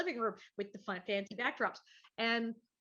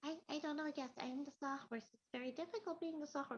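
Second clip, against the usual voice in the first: higher, softer, small Gracie Allen-like voice; a timid little wooden horse; high-pitched Sawhorse; 6 semitones higher, 8 dB quieter than usual. Acted. Reading Oz aloud, the Sawhorse in a small high voice. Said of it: "this little high-pitched, almost Gracie Allen".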